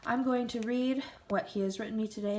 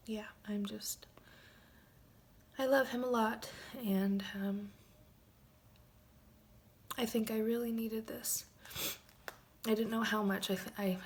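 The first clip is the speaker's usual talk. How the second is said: soft, unvoiced, choked up; emotional whisper; 3 semitones higher, 3 dB quieter than usual. Real, not acted. Wife thanking her absent husband, tearful whisper. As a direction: barely voice it, holding back tears. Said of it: "I'm getting a little emotional".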